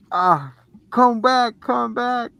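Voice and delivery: calling after the doll. Retold or imitated. Mom calls after the doll.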